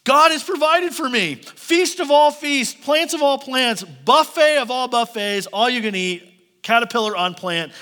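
high, loud, gleeful feasting voice, slowing on the feasts; a tiny bug thrilled at a buffet; giddy caterpillar. Pretend. Preacher voicing a hungry caterpillar, giddy and loud. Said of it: "he's like".

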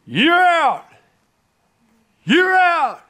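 high, loud umpire's call of out; umpire screaming. Retold or imitated. Preacher acts out the umpire's call to the crowd, shouting. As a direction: bellow the call across the whole ballpark. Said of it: "with his fists up in the air screaming".

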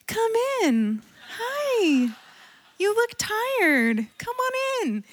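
high, sweet Southern-lady welcome; Southern hostess. Retold or imitated. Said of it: "she's like a Southern woman".

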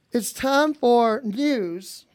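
very slow, drawn-out news intro; slow announcer voice. Acted. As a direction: stretch it like a TV anchor. Mock news show opening.

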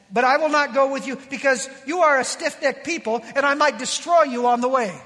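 loud, stern warning; forceful acted rebuke; ticked off God. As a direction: thunder a threat as an angry God. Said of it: "a very ticked off God".

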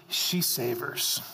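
slow and relishing, showing the pace; savoring slowly. Shown as a demonstration. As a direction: draw the word out like savoring food. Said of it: "She's just savoring".

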